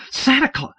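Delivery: Lily Mary's piercing cry of shock. Acted. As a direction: blurt it out in alarm. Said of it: "She began piercingly".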